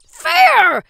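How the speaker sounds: loud high whining shout; tantrum yelling. A narrator's reading. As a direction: throw a full tantrum. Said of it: "punched the pillow out of frustration".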